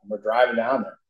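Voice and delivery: ordinary talk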